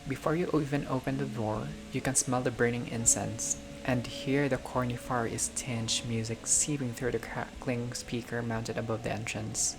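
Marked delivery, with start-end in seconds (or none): none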